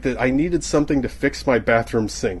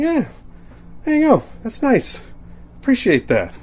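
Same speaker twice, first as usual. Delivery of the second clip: mock appreciation; 12 semitones higher than usual. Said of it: "And you're like".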